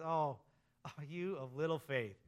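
a soft, head-shaking sigh, lightly voiced as God. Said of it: "kinda shakes his head and says".

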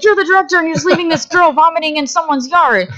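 high-pitched, incredulous scolding; mock alarmed reproach. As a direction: imitate a crew crying out at the director. Crew's alarmed reproach, acted out.

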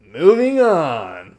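slow, drawn-out mimic; mocking imitation. Cohost mimicking his catchphrase, drawn-out. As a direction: stretch it out teasingly. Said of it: "Don't do that. It's my thing".

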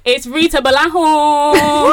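high, loud, drawn-out introduction; passionate. Real, not acted. Host hyping a good friend's introduction, passionate. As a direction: belt out the guest's name with gusto. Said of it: "You said it with so much passion".

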